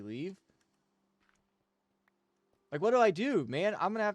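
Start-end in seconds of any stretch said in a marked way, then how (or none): 2.7-4.1 higher, louder exasperated plea; exasperated outburst. Real, not acted. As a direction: throw up your hands in frustration. Venting about no maternity leave.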